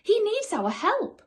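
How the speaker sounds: squeaky woodland creature voice; high creature voice. A narrator's reading. Creature asks the others to help the boy, squeaky and high.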